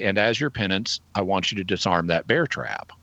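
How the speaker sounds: ordinary talk, nothing marked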